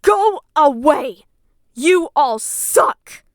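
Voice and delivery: slow, loud, drawn-out contempt; angry dismissal. Real, not acted. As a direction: push them all away, deliberately.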